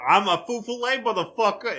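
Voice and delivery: a laughing New York gangster brag; mock New York accent; New Yorker accent. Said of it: "Why did he go New Yorker?".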